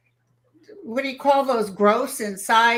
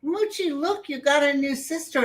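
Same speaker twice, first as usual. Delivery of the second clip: higher, lightly delighted pet-greeting voice; excited greeting, retold; 7 semitones higher than usual. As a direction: re-enact happily showing a dog the newcomer. Retold happy greeting to her dog.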